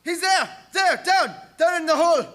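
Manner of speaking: high, loud shrieks of terror; mate spotting the creature in the hold; terrified screaming. Acted. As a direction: scream at what you see below.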